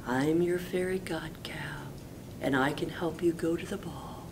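whispered fairy god cow. Acted. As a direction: softly reveal herself as magic. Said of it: "then said".

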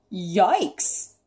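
startled yelp with a squeak; squeaked alarm. A narrator's reading. Octopus spotting the fierce eel, squeaked alarm. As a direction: yelp it like a mild fright. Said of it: "squeaked Octopus".

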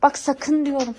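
ordinary delivery

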